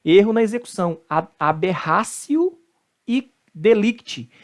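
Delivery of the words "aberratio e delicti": slow aberratio with an S sound, "aberrásio"; Latin T as C. Shown as a demonstration. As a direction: aberratio ending in "-sio". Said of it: "o T tem som de C".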